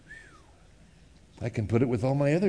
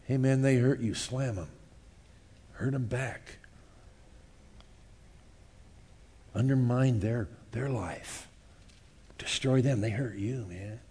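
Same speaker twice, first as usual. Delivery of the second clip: hissing revenge urging; 2 semitones lower, 6 dB quieter than usual. Acted. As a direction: egg them on quietly toward revenge.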